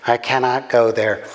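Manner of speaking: put-on American accent, panting complaint; panting American woman. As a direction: play a breathless American passenger. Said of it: "she panted".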